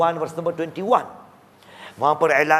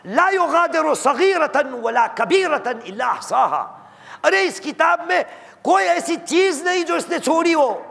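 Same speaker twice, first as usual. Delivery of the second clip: high-pitched, impassioned wail; preacher acting out the horrified sinners; aghast outcry; 13 semitones higher, 3 dB louder than usual. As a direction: cry out in dismay at the book of deeds. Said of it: "वहाँ पर लोगों कहेंगे".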